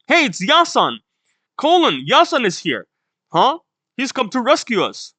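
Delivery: put-on high, eager child-like call; excited announcing. Kids spotting Yasan coming to rescue them, excited calling.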